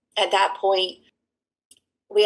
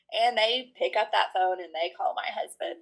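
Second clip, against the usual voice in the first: ordinary talk, nothing marked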